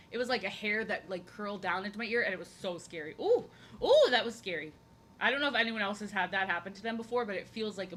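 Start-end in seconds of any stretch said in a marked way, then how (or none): 3.2-4.7 high squeal of fright; real fright. Real, not acted. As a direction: shudder it out, spooked. Spooked by a hair in her ear.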